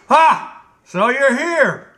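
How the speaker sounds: high, loud tyrant king yelling; furious royal shout. Acted. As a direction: drive the steward out in a rage. King greets the general angrily.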